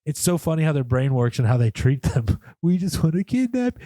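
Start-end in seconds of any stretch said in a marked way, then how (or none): 2.6-3.8 high-pitched imitation of dog talk. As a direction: coo it high, as if to a pet. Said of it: "talk to them like a dog".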